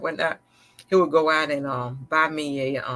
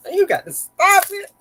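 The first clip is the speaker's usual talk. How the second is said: laughing protest; 8 dB louder than usual. Real, not acted. Laughing at the teddy bear joke.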